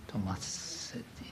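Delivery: Tomasetti breathed out as a whisper, no voice; whispered to himself. Real, not acted. Lecturer checking the artist's name, whispering it to himself.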